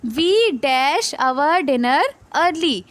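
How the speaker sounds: very slow, word by word; slow read-aloud. Shown as a demonstration. Teacher reading the example sentence, very slowly. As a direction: drag out each word for the class. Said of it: "इस वाली picture में देखना".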